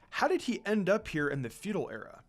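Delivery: usual speaking voice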